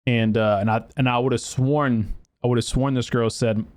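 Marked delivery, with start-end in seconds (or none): none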